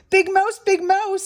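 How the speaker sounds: high small-mouse call; little mouse crying out. A narrator's reading. Little Mouse calling Big Mouse, high cry. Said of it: "he cried".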